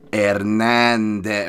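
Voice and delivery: put-on HERNANDEZ overdone, loud and drawn out in a Spanish accent; overdone name pronunciation. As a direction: stretch "Hernandez" slowly with exaggerated accented syllables. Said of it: "they almost overdo it".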